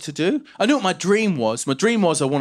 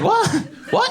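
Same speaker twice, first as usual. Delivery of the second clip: put-on high-pitched, baffled kid; imitating a stunned child; child's voice. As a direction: squeak it in disbelief. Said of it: "I said".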